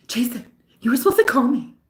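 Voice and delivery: high, quick put-on whine; mock movie-girl complaint to Jason; Mean Girls impression. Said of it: "that movie Mean Girls".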